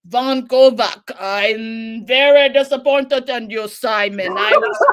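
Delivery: loud, slow, theatrical director scolding; a stern director, every word drawn out; disappointed director. Retold or imitated. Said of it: "he's like".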